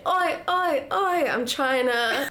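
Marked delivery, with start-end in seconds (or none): 0.1-1.3 higher-pitched Aussie chant; Australian accent attempt. Shown as a demonstration. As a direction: try an Australian "oi".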